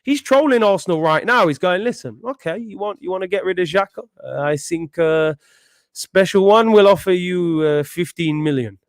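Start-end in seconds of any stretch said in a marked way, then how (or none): 1.8-8.8 lightly voiced Portuguese manager, playing it smug; Mourinho impression. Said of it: "I sounded like I was prime Portuguese".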